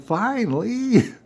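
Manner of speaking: high-pitched exasperated dismissal. Retold or imitated. To stranger begging to leave, exasperated.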